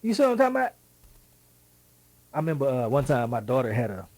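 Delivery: ordinary talk, nothing marked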